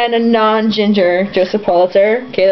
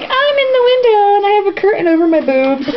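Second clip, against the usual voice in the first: high mock-prim Sarah voice; 10 semitones higher than usual. Teller mocking Sarah in the picture, high. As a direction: squeak it like a prim girl posing. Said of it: "Sarah being like".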